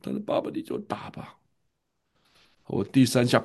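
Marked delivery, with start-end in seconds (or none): 0.2-1.4 whispered plea. Retold or imitated. Son whispers again to father.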